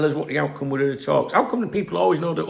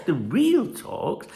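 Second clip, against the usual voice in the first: ordinary delivery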